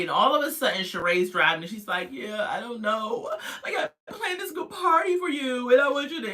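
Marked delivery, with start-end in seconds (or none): 2.1-6.3 fake crying. Girlfriend whining to him about the party she planned, fake crying. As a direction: wail it like badly faked tears. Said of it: "she saw this fake crying".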